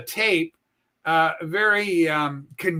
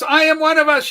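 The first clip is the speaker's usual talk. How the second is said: excited welcome; 8 semitones higher, 8 dB louder than usual. Real, not acted. On-air greeting of a caller.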